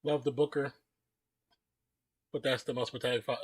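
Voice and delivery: talking while crunching chips. Real, not acted. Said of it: "Sorry about my crunchy ass chips".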